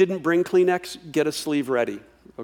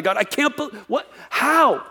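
lashing out at God; 7 semitones higher than usual. Retold or imitated. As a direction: throw up your hands at God. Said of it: "We tend to lash out against God".

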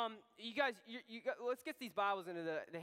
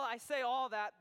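ordinary talk

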